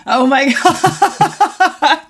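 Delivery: laughing disgust. Real, not acted. Reacting to a gross joke.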